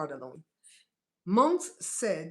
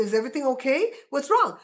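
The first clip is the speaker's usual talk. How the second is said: raised, louder worried question; alarmed concern; 5 semitones higher, 9 dB louder than usual. Acted. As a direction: react to someone crying on the phone. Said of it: "Unless she's on the phone crying".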